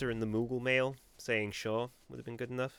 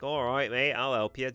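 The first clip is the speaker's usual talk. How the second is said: a hammed-up pirate accent, louder; 3 semitones higher, 5 dB louder than usual. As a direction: greet like a pirate in a mock pirate accent. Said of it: "Is this accent convincing you that I'm, like, a proper pirate".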